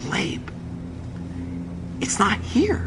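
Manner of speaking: very soft, hushed aside; whisper. Retold or imitated. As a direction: whisper it secretly to your husband. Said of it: "Chana whispers to me".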